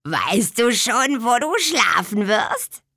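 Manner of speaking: kindly hag asking; old swamp witch. A narrator's reading. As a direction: swamp witch aunt asks where she sleeps. Tante Müffel voiced.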